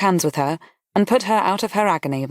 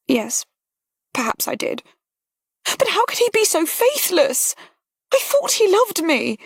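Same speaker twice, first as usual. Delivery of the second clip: put-on higher, wounded young lady; young woman betrayed, higher; hurt young woman; 14 semitones higher than usual. A narrator's reading. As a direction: plead it, heartbroken and higher. Voices Harriet, hurt.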